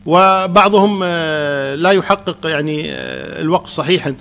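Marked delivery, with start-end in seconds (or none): none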